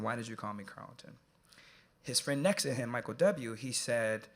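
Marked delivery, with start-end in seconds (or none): 0.0-1.2 much softer, steady, serious; intense hushed question. Retold or imitated. Speaker facing the boy in his row, asking much softer. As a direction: ask it eye to eye, holding him there. Repeats quietly.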